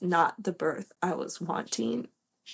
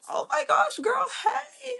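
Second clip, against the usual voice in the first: retold squeal of delight, far higher; excited greeting. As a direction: gush it like spotting an old friend. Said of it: "And I was like".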